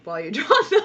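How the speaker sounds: breaking into laughter mid-sentence; amused at yourself, laugh through it. Real, not acted. Laughs at her own habit.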